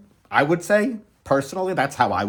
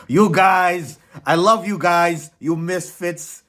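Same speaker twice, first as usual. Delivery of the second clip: louder, higher gush of love to the fans; gushing affection; 5 semitones higher, 4 dB louder, slower than usual. Acted. Host gushing over the Misfits crew, hammed-up love. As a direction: throw your arms open with mock adoration.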